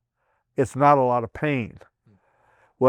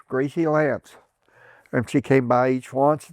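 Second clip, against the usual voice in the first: usual speaking voice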